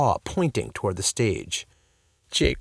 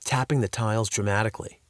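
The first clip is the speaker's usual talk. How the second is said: ordinary delivery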